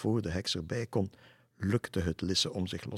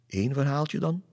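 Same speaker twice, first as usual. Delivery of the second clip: eager asking; 6 semitones higher, 5 dB louder than usual. Acted. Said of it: "vroeg hij".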